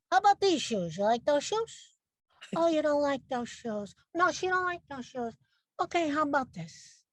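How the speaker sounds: lightly voiced Italian mother, teasing; mock-sweet shoe shopping with a sneer underneath; Italian mom. Italian mother at the back-to-school shoe shop, a touch of mock sweetness. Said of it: "Italian style".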